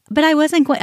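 quick retold whine of protest; mimicked protest. Mimics the girl's protest mid-quarrel.

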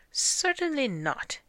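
high, loud, offended correction; indignant. A narrator's reading. Master rejects the wrong name.

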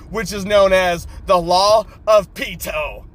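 usual speaking voice

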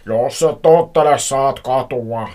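hammed-up villain's warning; shouted threat. A narrator's reading. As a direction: drag out the threat at full volume. Stranger threatens over the phone.

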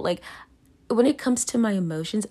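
ordinary talk, nothing marked

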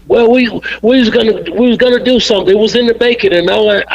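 lightly voiced promoter stammering an excuse; Bill making excuses. Bill's excuse in retold argument.

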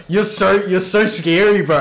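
high, loud sarcastic taunt; mock fear. Sales rep taunting the tough guy, mock fear. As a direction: act scared to make fun of him.

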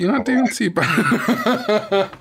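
laughing through the words. Real, not acted. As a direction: chuckle it out. Laughing praise.

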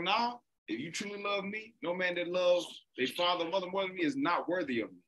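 high, firm, no-nonsense reply; Jesus answering firmly. Retold or imitated. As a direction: speak as a teacher settling it. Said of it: "But it's like".

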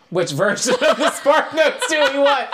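high, laughing question; real amused giggle. Real, not acted. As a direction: laugh it out, half-joking. Laughing over which story to tell.

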